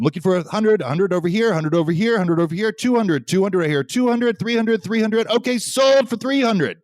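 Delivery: loud, high rapid auction calls; auctioneer. Pretend. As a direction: rattle off bids like a charity auctioneer. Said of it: "give your best auctioneer-like voice".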